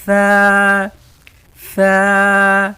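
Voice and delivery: ثا loud and high, tongue lightly between the teeth, air let out; a loud, light ثا with the tongue tip out; thin Tha. Shown as a demonstration. Teacher shows students the letter ثا, loud with the tongue between the teeth. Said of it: "جیسے میں پڑھ رہا ہوں ویسے ہی".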